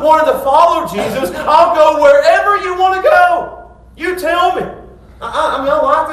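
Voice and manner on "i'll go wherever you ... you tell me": high, loud and bursting with eagerness; eager excitement. Retold or imitated. Scribe pledging to Jesus, loud eager excitement. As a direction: bounce like a puppy ready to go. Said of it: "He's eager. He's excited".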